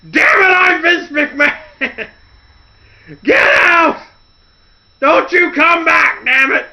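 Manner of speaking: very high, loud, slow mock rant; hammed-up furious boss screaming; Vince McMahon ranting. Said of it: "He's like".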